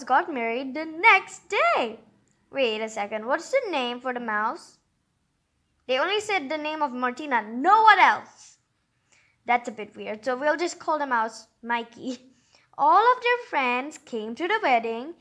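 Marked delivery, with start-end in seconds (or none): none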